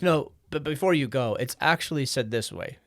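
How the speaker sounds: mock-insistent local correcting the tourist; pedantic German corrector. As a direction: hold them back to fix their grammar. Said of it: "They're like".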